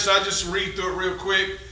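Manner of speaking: normal talking voice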